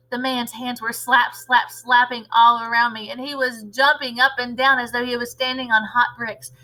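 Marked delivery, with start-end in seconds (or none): none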